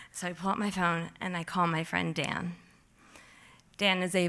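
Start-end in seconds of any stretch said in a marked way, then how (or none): none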